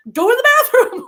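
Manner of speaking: high loud shout through laughter; laughing yell. Retold or imitated. Brothers sending her to the bathroom, high laughing shout.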